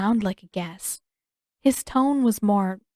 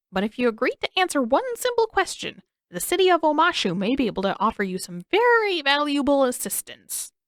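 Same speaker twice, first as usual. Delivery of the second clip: slightly crazed king; 6 semitones higher than usual. A narrator's reading. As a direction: tempt them like a half-mad old king. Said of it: "his expression becoming slightly crazed".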